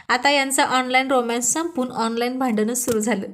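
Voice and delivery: ordinary delivery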